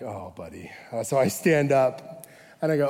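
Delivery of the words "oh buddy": much softer and lower, under the breath; a quiet pitying groan of words; pitying aside. Retold or imitated. Pastor inwardly pities the eager missionary, softly.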